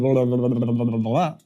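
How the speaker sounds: rapid monotone run of blahs; nonstop blabber. Shown as a demonstration. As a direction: babble it in one unbroken string. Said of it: "So what's going to happen is, instead of".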